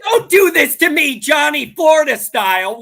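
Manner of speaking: loud, high exasperated plea; screaming. Real, not acted. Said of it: "you're screaming already".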